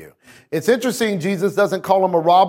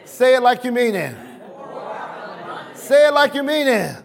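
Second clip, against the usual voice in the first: high-pitched call to the congregation; preacher demanding a heartier response; rousing exhortation; 6 semitones higher than usual. Real, not acted. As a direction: urge the crowd on, pitched right up. Urging congregation to respond louder.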